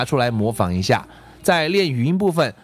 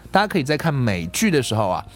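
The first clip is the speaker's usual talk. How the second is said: usual speaking voice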